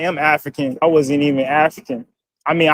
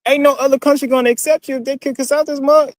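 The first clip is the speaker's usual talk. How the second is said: put-on Southern accent, pitched way up; fake Southern accent; 9 semitones higher than usual. Replying to a commenter in a fake Southern accent. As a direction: play it as an overdone Southern accent. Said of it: "fake-ass Southern accents".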